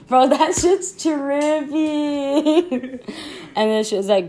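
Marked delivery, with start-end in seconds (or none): 0.1-3.2 high, loud, drawn-out giggly reaction; amused amazement. Real, not acted. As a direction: stretch it out slowly, cracking up. Amazed, amused by silly thought.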